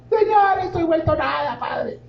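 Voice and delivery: high, pleading mock prayer that fades out; whiny mock prayer. Preacher mocking a complaining believer's prayer, high and whiny. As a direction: ham up a self-pitying plea to God.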